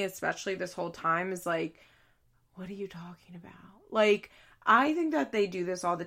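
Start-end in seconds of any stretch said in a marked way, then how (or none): 2.5-3.5 hushed, incredulous whisper; a sister's whispered eye-roll; whispered disbelief. Retold or imitated. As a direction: whisper it like you can't believe her. Sister reacting to Mackenzie's drama.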